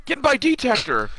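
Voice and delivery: high-pitched, drawn-out mock call; imitating JP. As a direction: call it out like a cartoon hero. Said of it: "and he goes".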